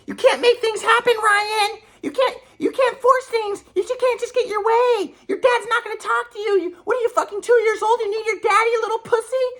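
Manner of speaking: very high-pitched mock mom voice; berating mom. Acting out mother berating him by phone.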